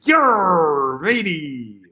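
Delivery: loud pirate shout. Retold or imitated. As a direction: bellow it like a pirate captain. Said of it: "they're looking for a pirate".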